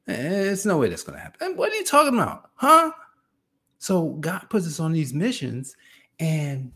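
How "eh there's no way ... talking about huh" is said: high-pitched disbelieving objection; incredulous protest. Acted. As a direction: rise into a baffled, loud "huh?". Acting out reaction to impossible mission.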